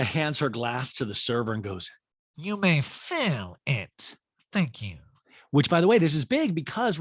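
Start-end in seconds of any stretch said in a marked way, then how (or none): 2.4-5.0 slow, drawn-out grand request; lightly hammed-up, languid drunk order; lightly voiced tipsy diva. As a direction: drag the words out like a tipsy diva. Recapper voices tipsy Erica.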